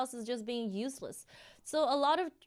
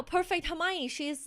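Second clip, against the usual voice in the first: normal talking voice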